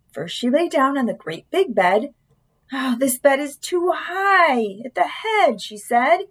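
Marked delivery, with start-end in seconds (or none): 2.7-5.6 slow, higher-pitched fussy complaint; complaining Goldilocks. A narrator's reading. Goldilocks complains about the bed.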